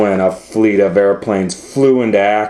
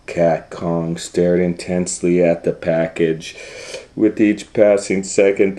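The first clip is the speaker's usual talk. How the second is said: plain reading voice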